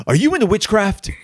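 high-pitched, urgent parental interrogation; hammed-up dad confrontation, pitched high; accusing dad. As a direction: voice an alarmed dad demanding answers. Dad confronts son in retold story.

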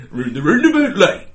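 loud, hooting Geordie imitation; mock Geordie accent. Second judge echoing the attempt, mock Geordie. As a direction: belt out a mock Geordie phrase. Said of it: "as Geordie accents go".

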